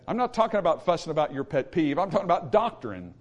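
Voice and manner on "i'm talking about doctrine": ordinary talk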